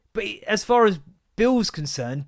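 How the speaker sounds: normal talking voice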